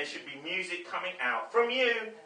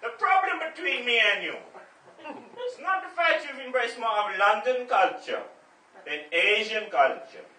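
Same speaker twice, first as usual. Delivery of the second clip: put-on dad's Indian accent; 11 semitones higher, 8 dB louder than usual. Father scolding his son over his bad accent, in Indian accent. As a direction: lecture your son in a heavy Indian accent. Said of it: "you do my accent so badly".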